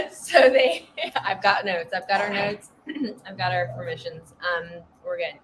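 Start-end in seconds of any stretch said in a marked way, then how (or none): 0.0-0.9 laughing. Real, not acted. To co-host after the joke, laughing. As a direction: laugh through it.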